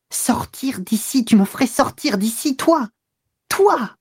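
whispery start climbing to a high-pitched cry; choking with anger. A narrator's reading. Woman threatened with eviction, suffocating with rage. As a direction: rise in stifled outrage to the last word. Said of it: "tant elle suffoquait de colère".

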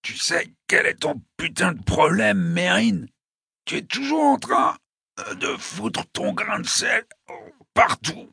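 high, strained, gasping reproach; hoarse with pain. A narrator's reading. Dying Mike scolding Mérine, hoarse and pained. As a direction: force the words out until they break into a groan. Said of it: "Sa voix était rauque et tendue par la douleur".

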